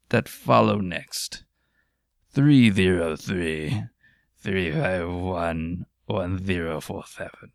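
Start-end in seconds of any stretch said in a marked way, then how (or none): 2.3-7.5 digits spelled out slowly and low; a slow, low drawn-out reading of the digits; slow, deep, dramatic number reading. Real, not acted. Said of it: "I don't know why I said it like that".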